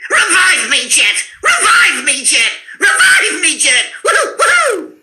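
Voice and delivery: very high cartoon duck cry; zany, high-pitched duck ending in whoops; Daffy Duck. Voice actor shows Daffy begging for revival, zany duck voice. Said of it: "we can do Daffy".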